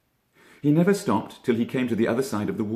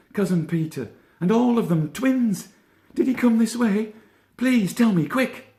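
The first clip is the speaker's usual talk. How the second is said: very high, loud, breathless rabbit pleading; a small rabbit begging for help, voice way up; frantic Benjamin; 5 dB louder than usual. A narrator's reading. Benjamin begging Peter for news, high frantic voice.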